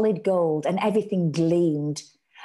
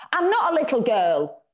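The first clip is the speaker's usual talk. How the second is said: put-on firm child's defiance, raised; stoutly; 7 semitones higher, 5 dB louder than usual. A narrator's reading. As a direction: stand up for yourself. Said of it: "Kim said stoutly".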